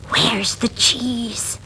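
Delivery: slow whisper. Acted. Miranda asking in the dark passage, whispering. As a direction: breathe the question slowly. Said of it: "she whispered".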